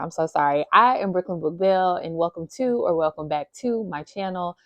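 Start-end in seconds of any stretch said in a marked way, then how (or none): none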